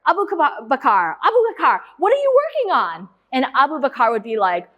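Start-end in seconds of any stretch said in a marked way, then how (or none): 0.1-3.1 high, friendly call to a student; Steve Wozniak. Retold or imitated. Segway rider greeting a student about a project, high-pitched imitation. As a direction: act out a keynote speaker zooming up, pitched high. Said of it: "And so he'd be like".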